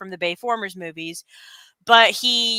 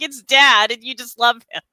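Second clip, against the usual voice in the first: usual speaking voice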